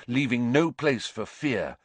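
ordinary delivery, nothing marked

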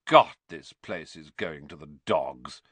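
low-pitched scornful complaint; loud disdain. A narrator's reading. Malfoy complaining about the school, with disdain. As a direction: complain for everyone to hear. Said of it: "said Malfoy loudly".

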